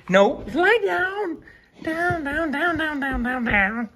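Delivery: high, slow sing-song commands to the dog; high-pitched commands. Real, not acted. Owner coaxing dog to lie down, high sing-song commands. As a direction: coax him down in a high voice.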